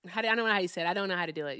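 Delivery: normal talking voice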